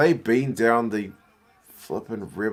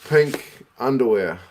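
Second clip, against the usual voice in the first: incredulous; 3 semitones higher, 7 dB louder, slower than usual. Real, not acted. Mocking player's pink underwear.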